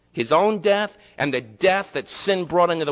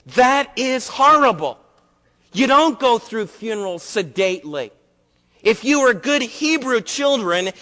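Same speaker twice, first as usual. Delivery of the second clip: loud, high preacher's cry, slowing on horrible; full-force preaching; 7 semitones higher, 5 dB louder than usual. Acted. Pastor scolding the congregation about calm funerals, full-force preaching. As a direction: thunder it at the congregation.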